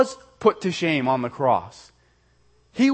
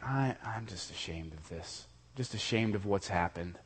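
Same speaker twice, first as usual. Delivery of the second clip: much softer, hushed, voicing someone's private shame; almost under the breath, weighed down by shame; ashamed inner voice; 9 semitones lower, 14 dB quieter than usual. Preacher voicing a shamed listener's thought, hushed and heavy.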